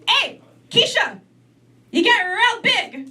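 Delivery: drawn-out, high teasing auntie voice; auntie's jab. Retold or imitated. Auntie teasing Keisha about her weight, high and slow. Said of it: "she goes".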